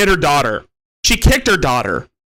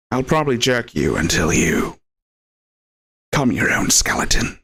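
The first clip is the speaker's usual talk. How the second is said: low, husky put-on menace; badly faked sinister voice, rough and low; Hat Man; 4 semitones lower than usual. Shown as a demonstration. Explaining the threat, still in the bad low impression. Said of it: "Hat Man impression".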